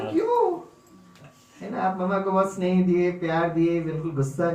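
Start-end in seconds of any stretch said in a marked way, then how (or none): 0.1-0.6 high surprised "क्यों?"; surprised why. Acted. Teacher to child, stunned by her request, high surprise. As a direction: ask it in sudden astonishment.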